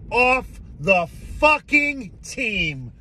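drawn-out gloating sing-song; gleeful. Real, not acted. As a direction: savor every word of it. Gleeful rant at departing player.

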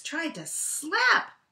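ordinary delivery, nothing marked

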